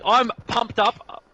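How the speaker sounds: pumped up. Acted. A host to a viewer, slow hammed-up hype. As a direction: stretch it out like a hype man. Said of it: "Are you pumped up for the finals".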